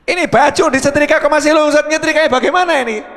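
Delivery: high, loud scolding; nagging husband. Retold or imitated. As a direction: scold the wife about the ironing. Husband complaining about ironing. (omelan suami yang keras)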